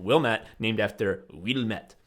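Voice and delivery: ordinary talk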